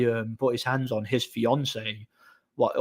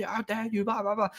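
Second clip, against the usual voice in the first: high, soft mimicked shrieking; mock screaming; 9 semitones higher, 6 dB quieter than usual. Woman going off at the man, high half-hearted mock screech. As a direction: imitate a screaming rant without the volume. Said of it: "screaming and shouting".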